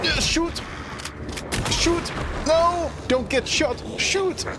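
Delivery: high panicked gamer yells; panicked yelling. Real, not acted. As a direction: yell in panic under fire. Panicked gamer under fire.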